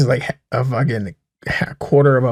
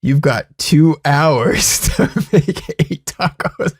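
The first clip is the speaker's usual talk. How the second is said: mock host announcement; 4 semitones higher, 3 dB louder, slower than usual. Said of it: "they're like".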